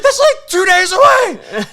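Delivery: high, loud, slow incredulous cry; can't believe what he's hearing; appalled disbelief. Retold or imitated. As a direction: ranger shouting in disbelief across the river. Appalled ranger.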